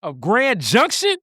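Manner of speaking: high, mock-scandalized Southern lady; hammed-up Southern lady. Friend mimics the Southern lady scolding the city visitor, high and hammed-up. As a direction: play the offended local, teasing. Mocking Southern lady's reply.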